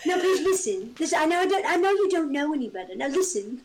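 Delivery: condescending Essex friend, hammed up; awful mock Essex accent. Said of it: "that accent was awful".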